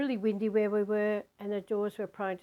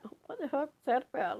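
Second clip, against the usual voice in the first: quick, higher retold disbelief; shocked at herself; 5 semitones higher, faster than usual. Sitting alone after the outburst, quick shocked self-questioning. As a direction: act out being startled by your own behaviour. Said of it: "shocked at my own outburst".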